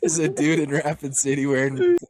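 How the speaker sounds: loud, amused delivery breaking into laughter; real laughter bursting around the words; laughing. Real, not acted. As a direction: crack up while announcing it. Cracking up at own story.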